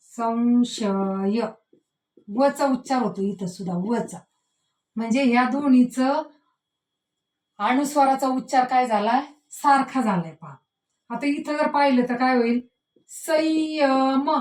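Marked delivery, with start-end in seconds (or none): 0.1-1.4 संशय stretched very slowly, the nasal sounding like व; slow demo pronunciation. Shown as a demonstration. Teacher demonstrating the व sound to pupils, very slow. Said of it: "संशय वचा उच्चार होतोय पहा". 13.1-14.3 संयम stretched very slowly, nasal heard as य; slow demo pronunciation. Shown as a demonstration. Teacher showing pupils the य sound, very slow. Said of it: "म्हणजे इथं य सारखा उच्चार होतोय".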